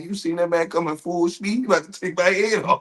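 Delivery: normal talking voice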